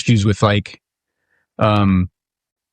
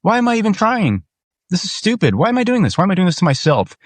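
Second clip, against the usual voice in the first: pitched high, frustrated, asking why he bothers; higher voice, fed up and self-questioning; exasperated self-doubt; 9 semitones higher than usual. Retold or imitated. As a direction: throw up your hands at yourself. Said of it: "And I'm like".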